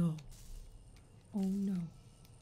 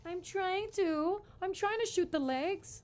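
high, drawn-out complaint; flustered protest; 4 semitones higher, slower than usual. Real, not acted. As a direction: protest helplessly, stretching the words. Flustered reply to viewer's advice.

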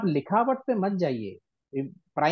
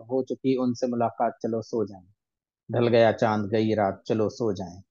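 ghazal recitation; 6 semitones lower than usual. Shown as a demonstration. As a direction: recite the verse gently. Recites ghazal lines softly.

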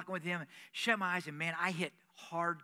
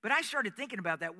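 ordinary delivery

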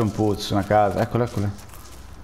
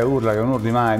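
normal talking voice

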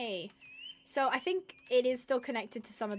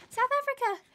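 excited; 13 semitones higher, 6 dB louder than usual. Real, not acted. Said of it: "My accent is not particularly good".

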